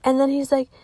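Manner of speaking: ordinary delivery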